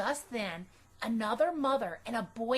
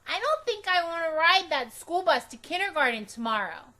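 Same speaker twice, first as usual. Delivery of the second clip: put-on higher, louder worried girl; reluctant about the bus; Junie B voice; 6 semitones higher, 6 dB louder than usual. A narrator's reading. Girl telling mother at bedtime she won't ride, high. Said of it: "I said".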